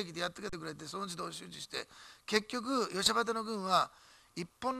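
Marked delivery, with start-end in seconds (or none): none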